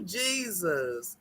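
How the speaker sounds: ordinary talk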